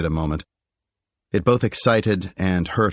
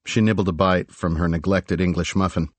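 ordinary delivery

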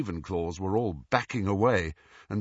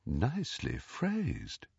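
slow, much softer approving knocker; a pleased, gentle eagle knocker; talking door knocker; 2 semitones higher, 8 dB quieter, slower than usual. A narrator's reading. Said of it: "replied the eagle door knocker".

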